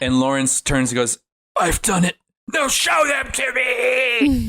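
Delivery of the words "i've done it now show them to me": high, drawn-out mock yell; hammed-up desperate cry. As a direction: wail the demand slowly, climbing high. Retold killer's demand to captor.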